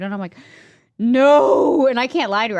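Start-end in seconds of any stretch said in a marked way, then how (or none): 1.0-1.9 blurted confession. Retold or imitated. Admits to trainer she didn't eat.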